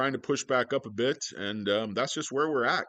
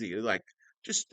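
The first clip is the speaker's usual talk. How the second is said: ordinary talk, nothing marked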